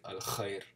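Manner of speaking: "al khair" with a deep guttural kh; deep throaty kh. Shown as a demonstration. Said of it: "But it's deeper".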